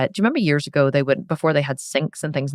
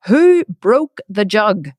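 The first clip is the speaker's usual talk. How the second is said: slow, loud, higher angry demand; angry shout; 4 semitones higher, 6 dB louder, much slower than usual. Retold or imitated. Dad demanding who broke the jug, slow angry shout. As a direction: drag out the angry question. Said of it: "and he was shouting".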